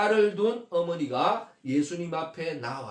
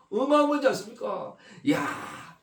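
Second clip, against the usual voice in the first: ordinary talk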